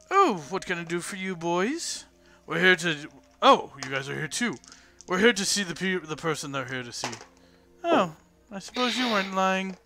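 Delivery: lightly voiced old landlady, lilting up and down; old woman grudgingly giving in; a hint of crotchety old innkeeper. Innkeeper greets the guards.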